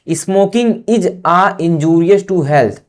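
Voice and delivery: very slow, word by word; slow dictation to a class. Shown as a demonstration. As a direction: read it for students to copy. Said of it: "dictating an example sentence slowly".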